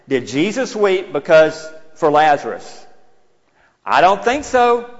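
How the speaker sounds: high, loud, impassioned preaching climax; preaching at full force. Acted. Preacher crying Jesus's call to Lazarus, impassioned full-force preaching. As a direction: cry it out to the congregation, building to the call.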